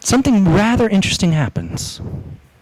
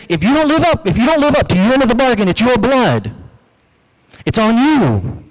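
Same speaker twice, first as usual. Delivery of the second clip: stern warning; 4 semitones higher than usual. Acted. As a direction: drive the threat home to them. Moses warning the people.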